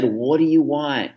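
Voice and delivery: a frustrated parent at the end of her rope; mild exasperated plea. Retold or imitated. Exasperated parent, crying baby in car.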